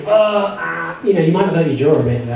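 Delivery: normal talking voice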